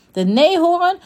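ordinary delivery, nothing marked

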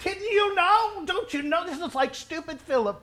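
ordinary talk

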